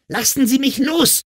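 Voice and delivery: very high acted scream; high, strained girlish scream. A narrator's reading. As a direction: shriek it to break free. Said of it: "schrie sie erneut".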